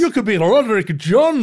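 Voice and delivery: hammed-up Scottish clerk voice; Scottish accent. Host answers the joke in mock Scottish accent. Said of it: "reverse compliment to your Scottish accent".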